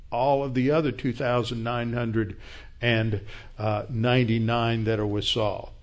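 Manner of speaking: usual speaking voice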